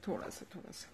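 whisper. Real, not acted. Aunt whispering to the baby to get him to smile.

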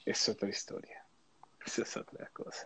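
breathy whisper, almost silent; whispered aside. Real, not acted. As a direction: drop to a whisper for the punchline. Susurra la frase a medias. (susurro casi inaudible)

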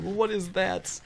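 lightly hammed-up disbelief; retold astonishment. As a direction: replay your own startled question. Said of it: "I was like".

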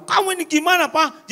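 high, exasperated scolding of the husband; nagging wife. Retold or imitated. Wife scolds her husband, high and exasperated. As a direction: snap at him like an irritated wife.

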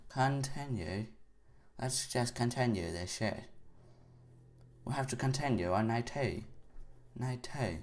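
soft, put-on British accent; poor British accent. Player choosing to continue night two, bad British accent. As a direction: attempt a British accent, none too well. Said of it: "my British accent is not very great".

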